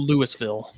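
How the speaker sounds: "Louisville" with the S sounded, LOO-iss-vill; pronounced Lewis-ville. Shown as a demonstration. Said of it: "It's pronounced Louisville".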